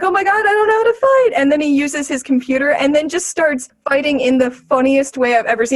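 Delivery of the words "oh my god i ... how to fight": high, fast panic; mock-frightened rookie; panicked. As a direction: blurt it out in mock alarm. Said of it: "he's like".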